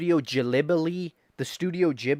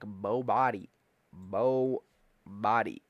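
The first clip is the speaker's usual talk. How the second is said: ordinary talk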